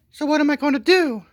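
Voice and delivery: very high, loud mock lady voice; falsetto woman. Wife asking security to unload the husband, in a high falsetto. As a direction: chirp it sweetly like nothing happened. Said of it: "so she's like".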